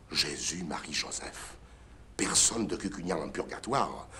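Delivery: whispered, breathless prayer of shock; dismayed whisper. Acted. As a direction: gasp the holy names under your breath.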